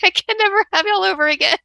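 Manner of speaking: high, fast squeal of a flustered mom; mock mortified mom. Mimicking the other mom after the haircut, high and fast. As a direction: ham up the embarrassed mother's panic. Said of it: "She's like".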